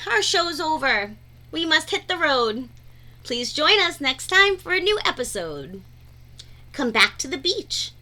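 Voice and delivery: ordinary delivery, nothing marked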